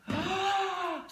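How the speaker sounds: put-on high surprised gasp for the kids; mock gasp. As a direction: gasp in mock shock at the sign. Reader reacts to the picture.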